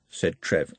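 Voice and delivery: ordinary delivery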